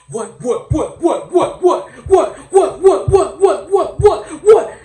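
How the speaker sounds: rapid volley of high, very loud yelps; hammed-up hype shout. Comic preacher hyping up [name], high frantic ad-lib yelling. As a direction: fire off the word in climbing, barked bursts. Mimics rapper's repeated ad-lib.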